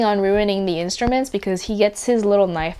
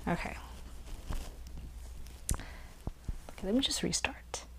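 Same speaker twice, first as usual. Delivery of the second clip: hushed unvoiced aside; whispering; 16 dB quieter than usual. Real, not acted. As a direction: whisper it under your breath. Whispering aside while restarting.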